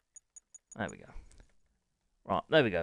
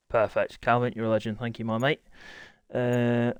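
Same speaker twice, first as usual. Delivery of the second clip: ordinary talk, nothing marked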